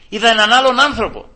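loud, high-pitched amazement; emphatic exclamation. Real, not acted. Preacher proclaims the coworker's change to the congregation, emphatic exclamation. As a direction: proclaim it with a sudden lift. Same testimony repeated with force.

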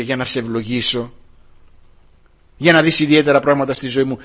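normal talking voice

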